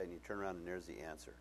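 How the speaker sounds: ordinary delivery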